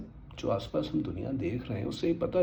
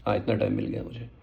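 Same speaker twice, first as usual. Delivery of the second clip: ordinary talk, nothing marked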